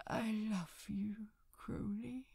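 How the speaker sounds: put-on drunken whispered confession of love; murmured. A narrator's reading. Angel drunkenly confessing love to Crowley, whispered murmur. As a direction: breathe it softly into his shoulder. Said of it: "he'd murmured".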